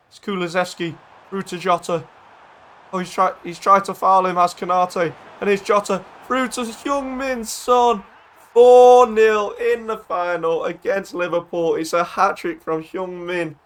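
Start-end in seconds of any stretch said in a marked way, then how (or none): 5.4-13.6 high, slow, drawn-out commentator shout; triumphant goal call. Real, not acted. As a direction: stretch the scorer and score out in celebration. Calls fourth goal of the final.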